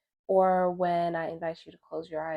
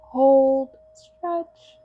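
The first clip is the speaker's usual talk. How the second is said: guided meditation; 4 semitones higher than usual. Acted. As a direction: guide them gently through breath and stretch, unhurried.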